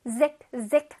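zik, zik with a buzzing z; drilling the zik sound. Shown as a demonstration. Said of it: "뮤직 아니고 music".

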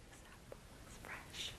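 whispered drill line; quiet repeat, all whisper. Shown as a demonstration. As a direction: breathe This apple looks fresh softly.

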